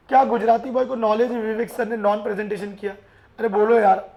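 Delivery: normal talking voice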